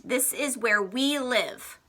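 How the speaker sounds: ordinary talk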